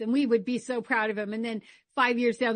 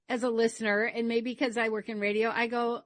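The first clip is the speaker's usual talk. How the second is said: ordinary delivery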